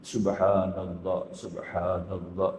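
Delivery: very slow, drawn out; slow, low careful chant; slow recitation. Shown as a demonstration. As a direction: stretch each syllable gently. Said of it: "Macam-macam pelan-pelan".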